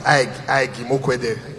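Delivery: slow, repeating Ike like a light stammer; mock stammer. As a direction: stumble gently on Ike, Ike. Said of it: "the stammer is so bad".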